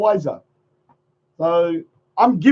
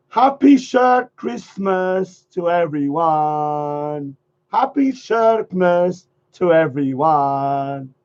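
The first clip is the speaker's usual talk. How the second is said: slow drawn-out greeting; much slower than usual. Acted. Slow festive greeting repeated.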